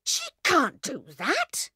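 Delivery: Bill protesting to the passengers; said loudly. A narrator's reading. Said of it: "he said loudly".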